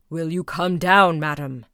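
loud, low furious demand; raging Bluebeard. A narrator's reading. Husband in a rage demanding his wife come down, low and loud. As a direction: explode with rage. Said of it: "in the greatest rage".

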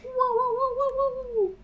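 squeaky high woo; high cheer. Real, not acted. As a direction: cheer them on. Cheering patrons.

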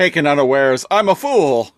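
high, loud hammed-up lament; mock melodrama. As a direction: play it like an old cartoon hero. Joining the hammed-up Primal line.

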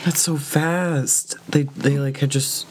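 high, slow, tearful voice; fighting back tears; crying. Real, not acted. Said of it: "I'm sorry, I'm crying".

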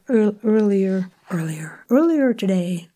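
labouring over American "earlier"; American accent attempt. Shown as a demonstration. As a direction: try "earlier" in American, varying each go. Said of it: "Try that one".

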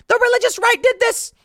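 high, loud shrieking blame; mock hysterical accusation. Woman blaming the religious right, high shrieking accusation. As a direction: shriek the blame at an unseen enemy. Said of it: "out of nowhere, she goes".

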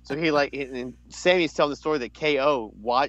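ordinary talk

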